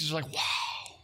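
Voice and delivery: breathy hushed "wow"; awed whisper. Acted. Preacher describing hearing Jesus speak, whispered in awe.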